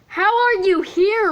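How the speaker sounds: high bewildered question. Acted. Mark asking Jack how he's here, bewildered. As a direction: ask in disbelief, pitch up.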